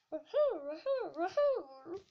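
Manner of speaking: high, loud whoops of glee; party whooping. A narrator's reading. Revellers at the Mad Hatter's tea party, whooping.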